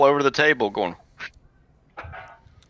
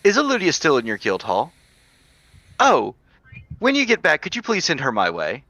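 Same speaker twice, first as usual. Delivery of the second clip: higher, lilting elf voice; 7 semitones higher than usual. Acted. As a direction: speak as a gentle elf. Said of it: "in that elf's voice be".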